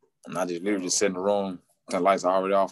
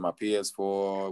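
slow sheepish answer; 3 dB quieter, slower than usual. Retold or imitated. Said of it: "I said".

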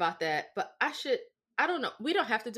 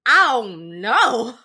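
very loud, drawn-out mock repeat; incredulous echo; 15 dB louder, much slower than usual. Niece throwing aunt's answer back, loud outraged echo. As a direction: throw the words back loudly, stretching each one. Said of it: "And I said".

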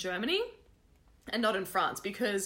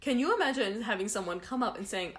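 normal talking voice

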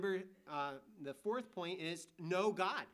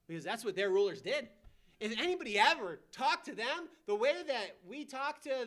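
preaching at full force; 6 semitones higher, 10 dB louder than usual. Real, not acted. Preacher builds to emphasis.

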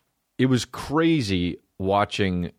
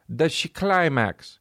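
put-on Italian accent; 7 semitones higher, much slower than usual. Italian voice in quoted dialogue.

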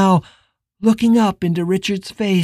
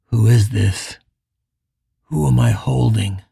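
lower, uneasy musing; doubting inner voice; 7 semitones lower than usual. Acted. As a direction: wonder aloud in doubt. Richard's doubts.

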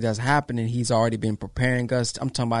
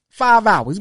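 emphatic; 7 semitones higher, 9 dB louder than usual. Real, not acted. Stressing the long door-knocking.

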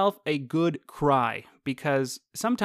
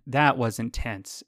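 ordinary talk, nothing marked

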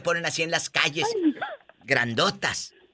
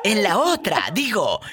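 lucha libre announcer; 6 semitones higher, slower than usual. Acted. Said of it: "en esta esquina".

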